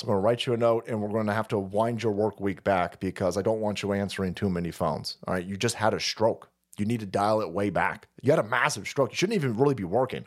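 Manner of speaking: low, lightly voiced doctor's advice; imitating a doctor. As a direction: talk the patient down in a low, matter-of-fact voice. Acted doctor advising stroke patient.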